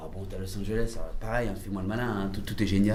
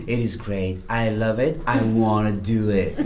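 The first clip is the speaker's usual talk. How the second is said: quoting her in English; 4 semitones lower, slower than usual. Retold or imitated. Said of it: "elle me rappelle, Manu".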